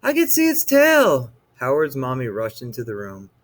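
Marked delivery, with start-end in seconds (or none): 0.0-1.3 put-on high, loud boy's voice; scared boy pointing out the tail; frightened child. A narrator's reading.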